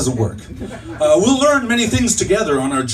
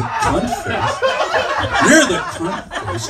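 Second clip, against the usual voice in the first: indignant retort; 11 semitones higher than usual. Acted. Mishears the guru, fires back.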